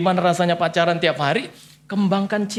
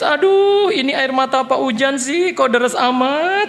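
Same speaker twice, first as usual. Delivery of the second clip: mock exclaim; 4 semitones higher, 5 dB louder, slower than usual. Husband mocks wife's crying.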